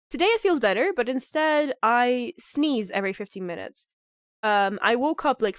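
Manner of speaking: ordinary talk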